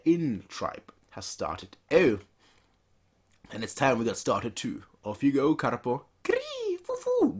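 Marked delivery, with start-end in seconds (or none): 6.2-7.4 high screeching bird call; eagle cry. Acted. Rider sending off his eagle, high bird screech. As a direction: shriek like a hawk.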